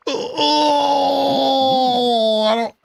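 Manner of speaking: high, wailing babble of despair; mock anguish. Streamer reacts to a painful fish pun, mock anguish. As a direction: wail and gabble as if the pun hurts.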